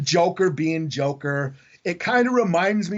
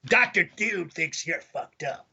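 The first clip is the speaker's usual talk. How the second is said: Doctor Doom voice; 3 dB quieter, slower than usual. Retold or imitated. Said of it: "Like, always the third person".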